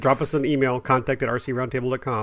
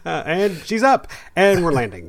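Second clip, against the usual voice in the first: laughing; 5 semitones higher, 4 dB louder, slower than usual. Pretend. Mock short flight.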